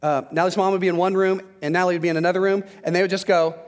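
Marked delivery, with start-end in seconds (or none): none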